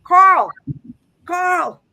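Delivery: loud, high calling out of a name; retold shout of the spirit, calling Carl; spirit yelling a name. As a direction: call the name out across a distance. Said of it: "but I just hear him go yelling".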